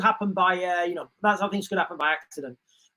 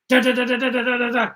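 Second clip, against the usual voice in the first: rapid da-da-da of imitated gunfire; mock gunfire; faster than usual. Seaman opening fire on the helicopter, mock gunfire noises. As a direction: rattle off the shots like a kid playing war.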